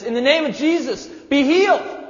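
very high, loud, straining command; forceful healing shout. Acted. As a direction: throw everything into the words. Second, stronger healing command.

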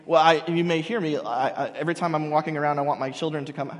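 ordinary talk, nothing marked

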